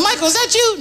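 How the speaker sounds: high surprised call to Michael. Retold or imitated. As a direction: call out in disbelief. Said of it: "I said".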